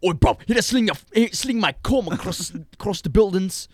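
high, loud mock London accent; mock British accent. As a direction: ham up a cheeky British villain. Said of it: "Definitely be British".

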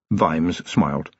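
ordinary delivery

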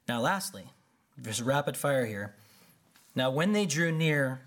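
ordinary talk

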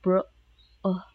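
"bro, uh" split by a clear gap between the vowels; little break. Shown as a demonstration. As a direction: break "brother" into "bro", pause, "uh". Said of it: "give a little break, giving a gap".

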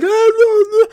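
high, frantic cries of no; frantic stranger. Retold or imitated. Stranger waves at the broken-down car, high frantic no. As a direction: mimic a panicking stranger. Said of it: "He's screaming at me".

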